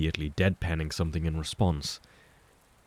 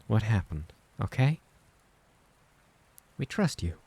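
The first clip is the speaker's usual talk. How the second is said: higher, gentle and insistent comfort; higher motherly reassurance with rising checks; reassuring mother; 7 semitones higher than usual. Acted. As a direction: soothe him, make him believe it. Clara reassures Mike.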